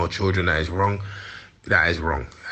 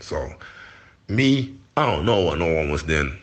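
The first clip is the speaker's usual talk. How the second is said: ordinary talk, nothing marked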